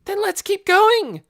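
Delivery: high, loud frantic plea; girl bouncing in her seat; frantic cry. Acted. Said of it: "Nikki cried".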